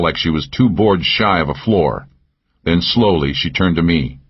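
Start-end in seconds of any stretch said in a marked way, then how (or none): none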